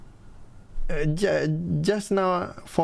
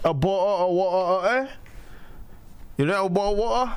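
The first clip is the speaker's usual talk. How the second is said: mock British accent, higher, glottal stop in bo-; British glottal demo; 6 semitones higher than usual. Shown as a demonstration. Showing how Brits say bottle of water, mock British accent. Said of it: "You just need to practice like that, mate".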